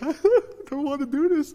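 high, whiny mock-tearful voice; hammed-up tearful reluctance; imitating crying girl. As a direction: squeak it out like a scared, crying teen. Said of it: "was crying".

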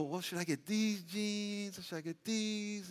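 mock a shopper who can't choose; dithering shopper. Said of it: "you go there and you go".

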